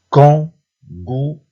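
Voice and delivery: KANGOUROU in three separate, loud syllables. Shown as a demonstration. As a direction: say "Kangourou" slowly, a gap after each syllable. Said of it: "repère le son qui se répète".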